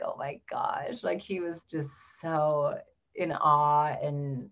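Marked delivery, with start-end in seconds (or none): 0.0-1.0 soft whispered wonder; hushed, breathless in awe; awed whisper. Retold or imitated. Husband seeing his newborn daughter, whispered in awe.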